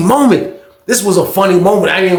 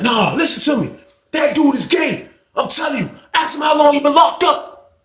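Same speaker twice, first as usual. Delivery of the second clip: heated accusation; 10 semitones higher than usual. Retold or imitated.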